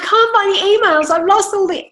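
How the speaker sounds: high loud distress; high loud distraught cry; distraught wail. Retold or imitated. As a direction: despair over the lost emails. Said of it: "And I'm like".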